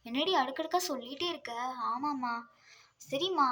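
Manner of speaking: ordinary delivery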